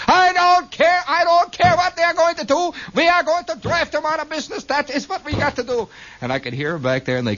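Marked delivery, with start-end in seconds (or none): 0.1-5.9 ranting dictator exhorting his troops, pitched very high; hammed-up high-pitched tyrant's harangue; Hitler. Store's dictator exhorting his troops in the back, a high-pitched mock rant. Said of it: "this Hitler exhorting his troops in the back".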